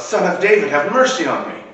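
loud desperate cry for mercy; calling out. Acted. Beggar crying out to a passing crowd, loud. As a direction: blind beggar shouting for Jesus to hear him. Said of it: "And he yells out".

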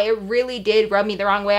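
usual speaking voice

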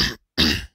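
loud rough throat clear. Real, not acted. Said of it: "I still have a frog in my throat".

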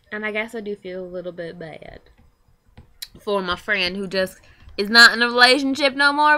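usual speaking voice

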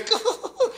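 put-on high squealing baby laugh; imitated baby giggle. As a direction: giggle like an infant. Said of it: "the baby tugs ... and goes".

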